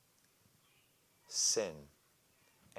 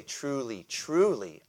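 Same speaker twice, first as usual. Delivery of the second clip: second "truly" higher and louder; emphatic repetition; 4 semitones higher, 3 dB louder, slower than usual. Shown as a demonstration. Preacher showing Jesus' emphatic repetition, rising. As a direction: build the repeated "truly". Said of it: "when he wanted to emphasize something".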